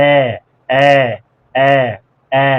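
এ drawn out with the mouth opened; open-mouthed vowel. Shown as a demonstration. Teacher drilling the vowel for learners, open-mouthed এ. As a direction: open up a little on each এ. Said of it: "একটু মেলবে".

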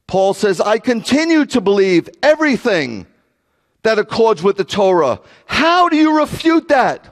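high, exasperated preaching; full-force preaching. Real, not acted. Preacher challenging pastors and rabbis to read Scripture, exasperated full-force preaching. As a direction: hammer it home, pitched high and insistent. Preacher drives argument home.

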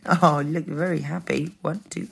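plain reading voice

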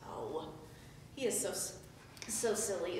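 ordinary talk

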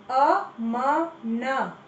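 अमन drawn out slowly as अ-म-न; syllable by syllable. Shown as a demonstration. Teacher sounding out अमन for children. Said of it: "सही उच्चारण करो".

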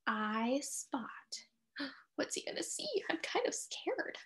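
scared whisper. Acted. Reader whispering to children at the scary part. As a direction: breathe it out like you're afraid.

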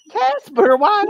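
shrill mocking sob-voice of the streamer; mock crying whine. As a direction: parody a sobbing tantrum.

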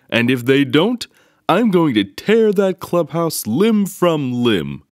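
enraged papa bear; furious roar. Acted. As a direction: tear it down, roaring. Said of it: "roared Papa".

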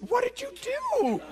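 high, slow mock wife voice, laughing; amused imitation of a worried wife; wife's concerned question. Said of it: "She said".

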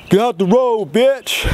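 high, loud shout out a car window; yelling at the girl who cut him off; retold yell. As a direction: bark it at the cyclist in the road. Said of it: "I just yell out the car".

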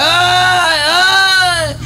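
high, loud pained "ay, ay"; pained cry. Retold or imitated. Secretary heard from the other office, crying out in pain. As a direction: yelp it out in pain from the next room.

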